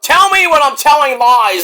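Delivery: hammed-up boast in a wrestler's voice; Stu Barrett. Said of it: "Stu, Stu Barrett impersonation".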